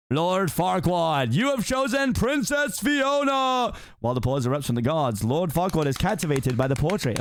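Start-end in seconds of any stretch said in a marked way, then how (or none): 0.1-3.7 high, slow game-show shout; host announcing winner. Acted. Mirror declares the lord's choice, high slow shout. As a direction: drag it out like a prize reveal.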